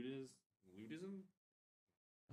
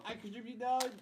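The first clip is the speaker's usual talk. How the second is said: higher, louder, playful declaration; excited announcement; 8 semitones higher, 8 dB louder than usual. Real, not acted. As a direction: declare it with gusto.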